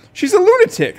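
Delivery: high loud breathless cry; gasping. A narrator's reading. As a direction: gasp it out, shocked. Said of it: "I gasp".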